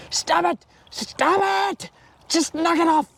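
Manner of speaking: high, strained mock scolding; exasperated parent. Parent to squabbling children, exasperated.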